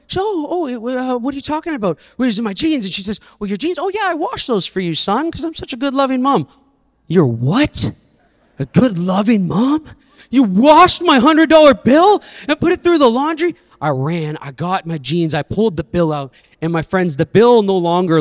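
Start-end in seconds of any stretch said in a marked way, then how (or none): 0.2-2.8 flustered mom. Retold or imitated. Mom caught off guard. 3.4-6.5 high, sing-song mom voice; sweet proud mom. Retold or imitated. Mom proudly explains washing. 7.1-13.5 put-on rising, disbelieving kid building to a loud high cry; a kid who can't believe what mom did; boy yelling at mom over the laundered bill, loud and high; outraged kid.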